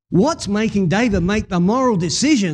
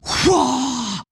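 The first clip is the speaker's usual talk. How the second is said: a sudden surprised shout; startled exclamation; 5 semitones higher than usual. Acted. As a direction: jolt back in shock. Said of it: "And Saul goes".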